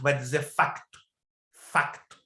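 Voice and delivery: "facto" com o "c" bem pronunciado, "fak-tu"; pronúncia portuguesa. Shown as a demonstration. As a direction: diga "Facto." marcando o "c" antes do "t". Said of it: "nunca vai dizer fato". ("facto" à maneira de Portugal, com o "c" audível)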